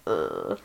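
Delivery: low, quiet groan; soft groan pitched right down; disgusted groan. Retold or imitated. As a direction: grumble a low "ugh" of distaste. Retold disgust at being assumed attracted.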